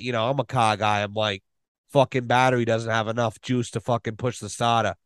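ordinary talk

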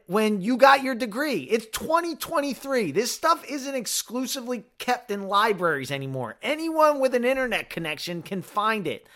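high, loud exasperated rant; furious yelling. Pretend. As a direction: yell it at her in outrage. Rant at the nurse he never said.

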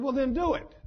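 high, insistent retold dare; challenging retort. Sinner challenges God to act.

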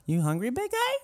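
high teasing voice; teasing sing-song. Pretend. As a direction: coo it at a big guy. Mocking voice to a friend.

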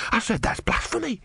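high, clearer horrified repetition; horrified, mouth uncovered. A narrator's reading. Disciple repeating himself with hand removed, clear high horror. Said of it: "Brother removed his hand".

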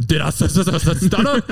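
high, repeated "st" before "stutter"; exaggerated joking stammer on "stutter"; mock stutter. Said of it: "like, a stutter".

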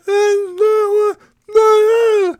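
high, frantic cries of no; frantic stranger. Retold or imitated. Stranger waves at the broken-down car, high frantic no. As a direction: mimic a panicking stranger. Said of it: "He's screaming at me".

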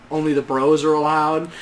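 ordinary delivery

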